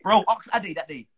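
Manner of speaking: ordinary talk, nothing marked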